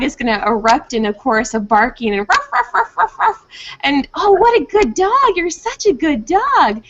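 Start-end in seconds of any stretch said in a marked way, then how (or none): 2.5-4.1 high yippy ruffs with a laugh; playful bark imitation. Trainer mimicking her dog's barking chorus, high and playful. 4.1-6.8 high, gushing praise, stretched out slowly; lavish a good dog with sing-song praise. Shown as a demonstration. Trainer showing owners how to praise a barking dog, high and gushing. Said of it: "praising the dog demo".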